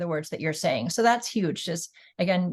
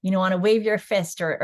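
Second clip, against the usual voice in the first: normal talking voice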